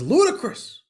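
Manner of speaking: playing the husband's mock outrage; offended husband. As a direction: scoff like a man insulted by the question. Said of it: "He gets so offended".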